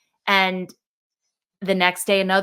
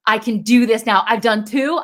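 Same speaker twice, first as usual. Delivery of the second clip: louder, higher, buoyant with relief; relieved excitement; 3 semitones higher, 7 dB louder than usual. Retold or imitated. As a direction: breathe out and cheer yourself on. Relief after one puppy adopted.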